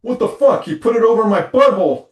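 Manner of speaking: hammed-up indignant shout; angry outburst. Husband yells at wife over the misplaced Band-Aid, mock outrage. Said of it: "And he says".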